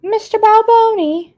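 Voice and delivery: summoning husband from another room; calling out. Real, not acted. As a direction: yell his name to get his attention.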